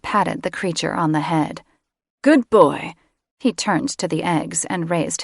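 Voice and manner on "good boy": louder, higher, doting praise; praising pet. A narrator's reading. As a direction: praise him like a dog. Patting creature on the head.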